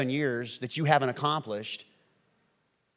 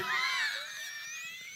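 high shrieking laugh; 8 dB quieter than usual. Real, not acted. Pastor laughing at the leader's worry, high shriek. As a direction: cackle in disbelief.

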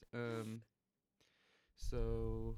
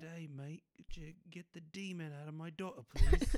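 put-on Australian accent; 6 semitones higher, 8 dB louder than usual. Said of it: "Toni Collette is Australian".

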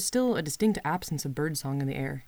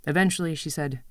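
ordinary delivery

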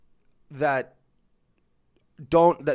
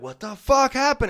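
alarmed; 7 semitones higher, 5 dB louder than usual. Retold or imitated. Adults alarmed by crying kid.

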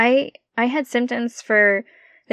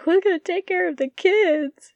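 panicked; 10 semitones higher than usual. Retold or imitated. Panicked retold self quote.

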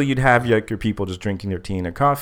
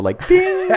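ding sound effect; 3 dB louder than usual. Retold or imitated. Idea light above his head.

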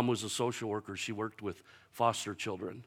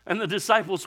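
ordinary talk, nothing marked

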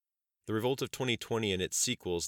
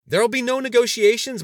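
high, very loud exclamation; forceful, high-pitched interruption; raising voice over another; 15 dB louder than usual. A narrator's reading. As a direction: shout over the other speaker. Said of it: "raising his voice over the general's".

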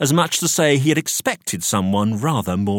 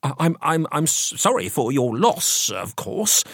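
higher-pitched flustered stammer; stammering, taken aback; 6 semitones higher than usual. A narrator's reading. Solicitor offering condolences to widow, stammering. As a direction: stumble over the first words, thrown. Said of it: "stammered the solicitor, rather taken aback".

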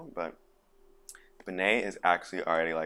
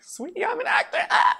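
very high, loud mock squeal; hammed-up producer; 9 dB louder than usual. Paraphrasing producer's reply to actor, hammed-up squeal. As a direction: squeal it in an exaggerated falsetto.

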